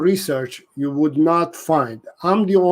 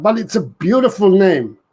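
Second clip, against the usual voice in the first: normal talking voice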